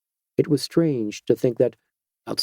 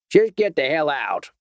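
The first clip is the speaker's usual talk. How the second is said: higher Georgia-twanged vigilante, sly and unhurried; a lightly voiced Georgia backwoodsman toying with the stranger; 5 semitones higher than usual. A narrator's reading. Vigilante warns the doctor off in a Georgia twang. Harry orders him off.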